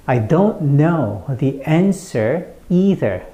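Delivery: slow, separated beats on "don't", "know", "answer", "either"; broken but precise. Shown as a demonstration. Teacher repeats for learners, slow separated stress on "don't know", "answer", "either". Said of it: "끊어서, 하지만 정확하게".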